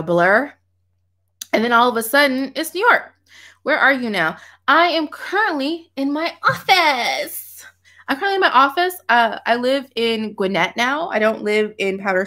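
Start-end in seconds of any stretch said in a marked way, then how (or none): 4.7-7.6 slow, high, drawn-out mock-grand answer; hammed-up announcement. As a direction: stretch it out theatrically, pitched high. Hammed-up answer, then repeated normally.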